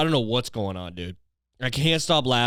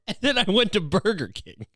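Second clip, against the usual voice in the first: words through laughter; real laughter, higher pitch; laughing; 9 semitones higher than usual. Real, not acted. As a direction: laugh while saying it.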